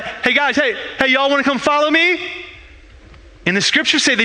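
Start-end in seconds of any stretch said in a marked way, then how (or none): 0.0-2.2 Jesus calling out. Acted. Jesus calls out to fishermen on the water, a light raised call. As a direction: hail the fishermen from the shore, pitched up. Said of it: "he yells out".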